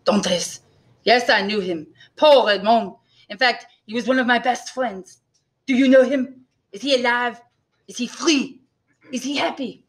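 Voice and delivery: eager innkeeper. A narrator's reading. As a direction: ask hopefully in a put-on accent. Innkeeper asks after lost friend.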